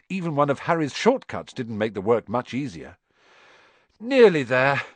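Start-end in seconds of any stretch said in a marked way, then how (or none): 4.0-4.8 out of breath, louder; panting. A narrator's reading. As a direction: gasp it out while hauling. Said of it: "Harry panted".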